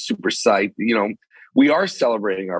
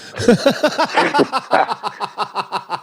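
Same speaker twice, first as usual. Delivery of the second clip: high-pitched laugh; a real laugh, pitched way up; burst of laughter. Real, not acted. Laughing at own admission.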